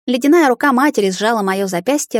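usual speaking voice

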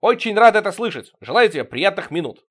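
loud, high mock-hearty reply; shouting back. A narrator's reading. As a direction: call back across the flat. Shouted reply across the flat.

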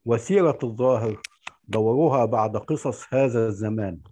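ordinary talk, nothing marked

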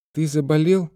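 put-on high-pitched child's question; little girl checking on a pet. A narrator's reading. Little girl asks the penguin.

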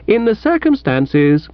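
measured, with a touch of frost; a little frigid. A narrator's reading. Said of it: "I replied a little frigidly".

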